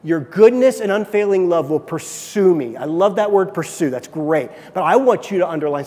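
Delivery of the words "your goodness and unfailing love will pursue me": loud preached scripture; forceful scripture reading; preaching loud. Acted. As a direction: proclaim the verse to the room.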